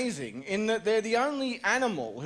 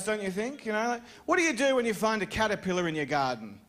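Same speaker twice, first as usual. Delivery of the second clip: ordinary delivery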